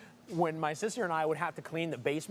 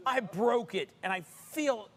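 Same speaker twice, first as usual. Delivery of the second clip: mock-frustrated, higher-pitched whine; fake frustration; 9 semitones higher than usual. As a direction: put on frustration for the wife. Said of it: "I'm smiling the whole time".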